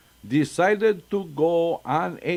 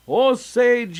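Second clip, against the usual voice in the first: gasped; 7 semitones higher, 5 dB louder than usual. A narrator's reading. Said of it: "he gasped".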